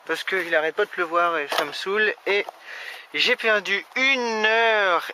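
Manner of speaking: loud, fed-up ranting; annoyed. Real, not acted. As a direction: vent real frustration. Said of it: "je suis bien énervé".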